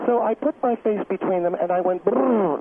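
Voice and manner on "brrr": drawn-out lip-buzzing brrr; motorboat noise. Shown as a demonstration. Guest shows the host the move she made, buzzing brrr. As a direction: blow a long buzzing raspberry-like brrr. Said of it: "like that, like a man would".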